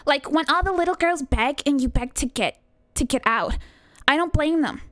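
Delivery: put-on Californian doll, lilting upward; self-satisfied American doll. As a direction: confide like a vain, picky doll. Continues the doll's monologue.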